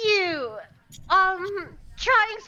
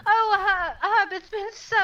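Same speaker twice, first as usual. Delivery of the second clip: Jonah overjoyed; 6 semitones higher than usual. Acted. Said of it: "he's like".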